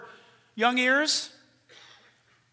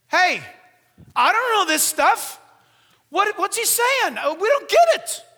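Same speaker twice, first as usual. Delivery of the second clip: high, loud, bewildered outburst; interrupting congregant; 11 semitones higher, 11 dB louder than usual. Acted. A woman interrupts Paul's teaching, loud and confused. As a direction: shout out your confusion from the pews. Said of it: "a woman would stand up and say".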